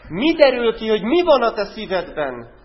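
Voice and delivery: fiery pulpit challenge; loud challenge. Real, not acted. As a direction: press the listeners hard. Same question asked again louder.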